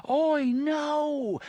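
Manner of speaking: high, far-off cry; as if heard through doors; faint distant scream. Acted. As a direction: Roland hears Jake faintly.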